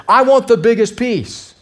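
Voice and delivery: put-on a boy loudly demanding cake. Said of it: "says in a very loud voice".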